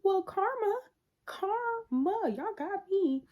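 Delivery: high, sing-song mock resignation; mock resigned karma. Tony catching them, high mock resignation. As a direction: shrug it off with a high sigh. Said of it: "Tony goes".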